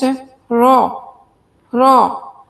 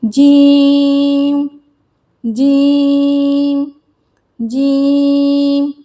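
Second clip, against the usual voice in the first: slow drawn out jeem; 4 dB louder, much slower than usual. Shown as a demonstration. Teacher drilling जीम slowly with the class. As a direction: stretch जीम long and slow. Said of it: "चलिए practice करते हैं".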